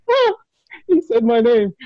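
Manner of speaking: high-pitched fan swoon; mock-overwhelmed fan voice; starstruck. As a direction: gush like a thrilled fan, acted out. Acting out his starstruck reaction.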